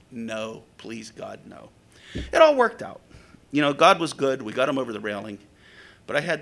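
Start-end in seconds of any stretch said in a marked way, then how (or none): 0.1-1.7 low, hushed plea to God; retold pleading. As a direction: plead softly under your breath. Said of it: "and I'm like".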